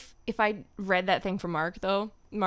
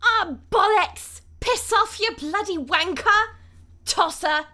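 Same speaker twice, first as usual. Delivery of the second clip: high, loud cursing outburst; hammed-up high-pitched swearing; Tracer impression; 9 semitones higher, 7 dB louder than usual. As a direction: hurl the British insults, pitched well up. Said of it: "let's do the Tracer thing first".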